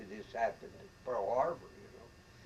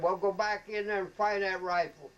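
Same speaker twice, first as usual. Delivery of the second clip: loud, higher barked command; retold colonel order; 7 semitones higher, 7 dB louder than usual. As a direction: send him back in with a shout. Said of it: "yelling at everybody".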